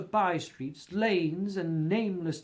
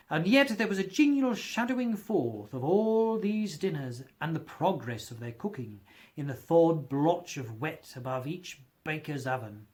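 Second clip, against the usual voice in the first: plain reading voice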